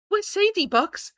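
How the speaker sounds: high, alarmed question; urgent fear. Acted. Iggy asking Bucks about Sadie, alarmed. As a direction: demand it high and scared. Frightened Iggy demands answer.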